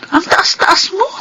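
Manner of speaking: high, frustrated reproach; wife pleading with her proud husband; exasperated rebuke. Acted. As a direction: scold him for his pride.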